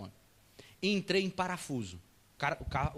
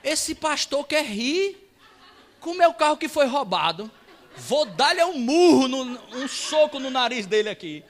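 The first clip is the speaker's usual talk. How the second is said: loud, high, slow mock threat; imagined angry man; 6 semitones higher, 9 dB louder, slower than usual. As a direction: seethe aloud like someone ready to punch. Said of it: "ele olhou pra mim assim". (ameaça indignada, encenada em voz alta)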